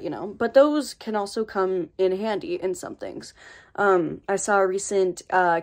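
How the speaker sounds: ordinary talk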